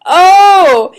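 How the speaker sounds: loud, high drawn-out "oh" of realization; sudden realization. Retold or imitated. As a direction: re-enact the penny dropping, loudly. Speaker acts out her sudden realization.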